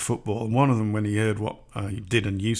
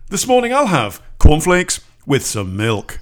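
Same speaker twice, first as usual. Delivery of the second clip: slow, loud newsreader delivery over breakfast; news broadcast voice; 3 semitones higher, 8 dB louder than usual. Retold or imitated. Joking about newsreaders at breakfast, mock broadcast voice. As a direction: announce cornflakes like the headlines. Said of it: "the news broadcast voice".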